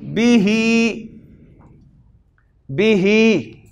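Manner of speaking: बिही drawn out slowly, हि with a kasra vowel; slow bihi. Shown as a demonstration. Teacher to students learning Arabic pronouns, saying बिही slowly. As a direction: say बिही slowly, stretching each syllable. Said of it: "तो ये बन जाएगा जी".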